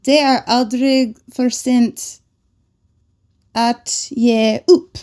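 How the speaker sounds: 'Det är aldrig för sent att ge upp' spoken very slowly; 'att ge upp' drawn out with 'ge' as 'ye'; slow careful Swedish. Shown as a demonstration. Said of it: "slow learner version".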